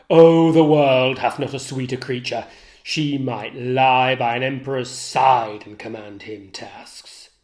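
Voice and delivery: jealous Othello raging at Iago; enraged Othello. Acted. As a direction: let the fury burst through the lines.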